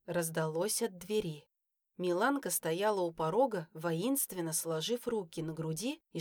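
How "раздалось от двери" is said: plain reading voice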